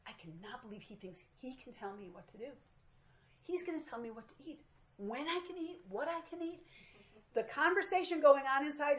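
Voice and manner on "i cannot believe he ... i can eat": inner angry thought. Retold or imitated. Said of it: "angry thinking".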